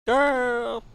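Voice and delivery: shrieked call. Real, not acted. Sudden outburst at the game.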